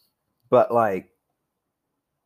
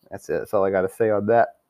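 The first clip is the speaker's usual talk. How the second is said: ordinary delivery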